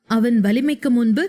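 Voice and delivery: usual speaking voice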